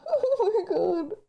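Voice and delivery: tearful laugh breaking into crying; overwhelmed streamer fighting back tears; choked up. Real, not acted. Thanking viewers for gifts, voice choking with tears. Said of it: "I'm having a moment right now".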